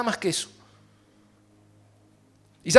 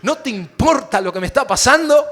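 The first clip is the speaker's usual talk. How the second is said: reproachful complaint; 7 semitones higher, 10 dB louder than usual. Retold or imitated. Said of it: "yo lo veo tan argentino ese".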